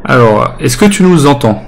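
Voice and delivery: son saturé, micro qui crache; voix distordue par la saturation. Real, not acted. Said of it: "ça sature". (micro saturé, son distordu)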